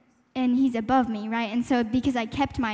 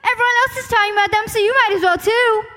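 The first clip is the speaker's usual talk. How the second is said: much higher, louder and fast, egging someone on; loud pushy voice; 10 semitones higher, 9 dB louder than usual. Shown as a demonstration. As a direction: blurt it like a loud crowd pressing you. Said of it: "It's gonna be like".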